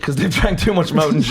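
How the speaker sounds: ordinary delivery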